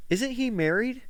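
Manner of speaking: incredulous question. Retold or imitated. Guest questioning the host's pitch, incredulous. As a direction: pitch the mock question up high. Retelling her pointed question.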